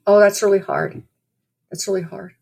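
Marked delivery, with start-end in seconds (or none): 1.7-2.3 much softer, gentle echo; quietly repeating the comfort. Retold or imitated. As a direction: let it drop to a hush. Comforting a crying stranger.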